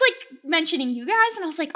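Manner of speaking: ordinary talk, nothing marked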